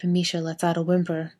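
ordinary delivery, nothing marked

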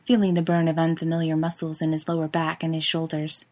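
ordinary delivery

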